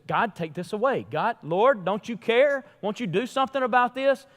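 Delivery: rising from a plea to a high desperate cry. Acted. Paul begging God.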